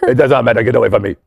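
a lightly put-on French accent for talking shells. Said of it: "That was my French accent".

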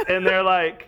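ordinary talk

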